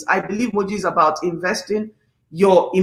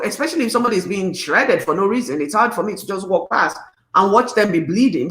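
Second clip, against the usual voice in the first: ordinary talk, nothing marked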